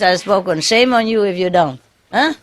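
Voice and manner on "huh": ordinary talk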